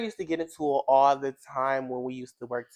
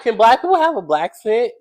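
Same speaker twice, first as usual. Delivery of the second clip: high, loud mock bafflement; incredulous question; 8 semitones higher, 10 dB louder than usual. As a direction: throw the absurd idea back as a question. Retells her incredulous question.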